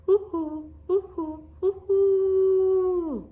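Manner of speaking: high, loud ooh-hoo owl call; drawn-out owl hoot. Acted. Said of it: "That's the sound of the great horned owl".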